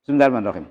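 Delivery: Bismillahirrahmanirrahim gabbled, its letters merged; careless, run-together Bismillahirrahmanirrahim; slurred Basmalah. Shown as a demonstration. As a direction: say Bismillahirrahmanirrahim as a quick mumbled "semndarmanrokim". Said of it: "ketika ada orang mengatakan atau mengucapkan".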